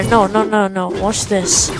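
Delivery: ordinary talk, nothing marked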